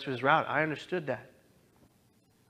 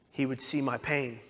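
ordinary talk, nothing marked

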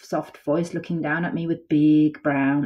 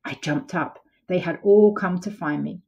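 usual speaking voice

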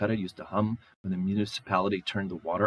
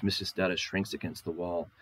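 normal talking voice

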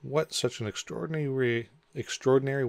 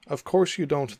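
higher, louder, dismissive Hatter; a loftily scornful retort; contemptuous; 8 semitones higher, 6 dB louder than usual. A narrator's reading. Hatter brushes Alice off, contemptuously. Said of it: "tossing his head contemptuously".

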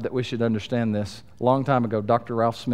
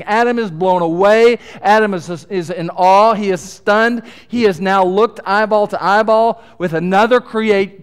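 louder, higher celebratory sermon; full-force preaching; 7 semitones higher, 7 dB louder than usual. Acted. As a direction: proclaim it with joy and awe.

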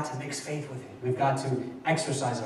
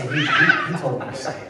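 laughing joke; 5 dB louder than usual. Pretend. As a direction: giggle as you pass the blame. Joking blame on Ken.

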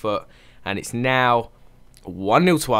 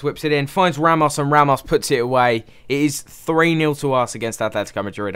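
excited goal call; 5 semitones higher, 6 dB louder than usual. Real, not acted. His team scores a goal.

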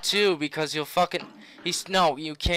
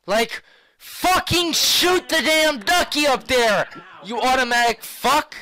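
angry yelling; 8 semitones higher, 4 dB louder than usual. Real, not acted. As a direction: yell at the game. Gamer yelling at game.

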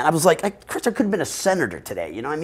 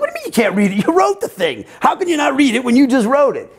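higher, disbelieving challenge; incredulous objection; 9 semitones higher than usual. Acted. Listener challenges the man who says he can't read, incredulous. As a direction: pitch it up in mock disbelief.

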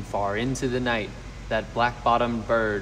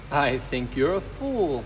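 slow, drawn-out taunt; mocking laugh; 3 semitones higher than usual. A narrator's reading. Sour kangaroo ridiculing Horton, mocking and slow. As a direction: sneer it slowly with a touch of amusement. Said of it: "laughed the sour kangaroo".

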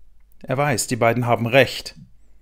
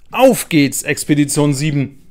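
loud, rallying cheer; rally the team off on adventure; excited call; 5 semitones higher, 9 dB louder, slower than usual. A narrator's reading. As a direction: Steffi cheering the group onward. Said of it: "ruft Steffi".

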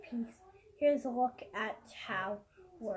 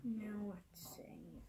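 whispering; 6 semitones lower, 17 dB quieter, slower than usual. Real, not acted. Quiet focus while building.

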